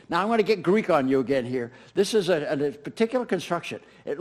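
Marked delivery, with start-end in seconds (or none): none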